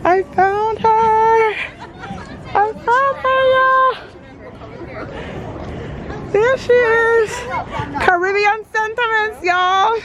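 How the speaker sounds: excited. Real, not acted. Vlogger finding her cousin's stall, high giddy excitement. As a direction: call it out bursting with excitement.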